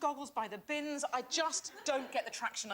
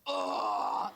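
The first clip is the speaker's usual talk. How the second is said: very low, loud "oh"; booming mock roar, far below usual pitch; imitated audience roar; 6 dB louder than usual. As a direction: mimic a deep crowd cheer. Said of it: "now it's high-pitched".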